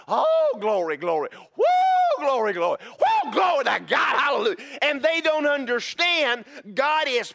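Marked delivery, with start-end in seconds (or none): 0.1-4.6 loud, ecstatic praise cries; whooping glory shouts; mock worship shout. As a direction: imitate someone caught up in worship. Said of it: "you're just like".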